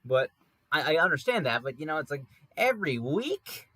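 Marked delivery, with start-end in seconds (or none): 2.5-3.7 incredulous. Real, not acted. Incredulous about neighbor mowing.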